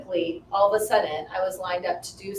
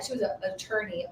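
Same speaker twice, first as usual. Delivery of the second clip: ordinary talk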